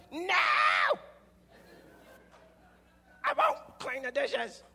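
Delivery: loud, high mock outburst; hammed-up defiant yell. Stepson snapping back at his stepfather, hammed-up loud yell. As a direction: explode in exaggerated refusal, drawn out.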